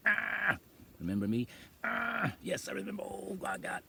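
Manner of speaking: high, taunting voice of the shingles pain; the pain talking. Retold or imitated. Telling viewers how the shingles pain taunts her. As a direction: let the pain itself speak, mocking and cruel. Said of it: "It just says".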